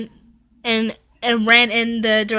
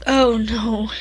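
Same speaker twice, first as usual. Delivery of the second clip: usual speaking voice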